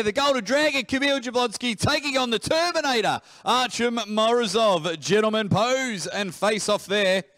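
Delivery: big, high, loud fighter introduction; ring announcer. Acted. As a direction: announce them like a fight night.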